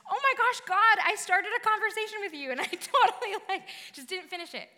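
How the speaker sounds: high, loud burst of mock alarm; sudden flustered realization. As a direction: blurt it out high, laughing at yourself. Acts out sudden realization in prayer.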